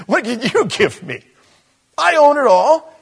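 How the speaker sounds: high, incredulous comic God; loud, high-pitched mock indignation; scoffing God. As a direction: scoff as if offered something laughable.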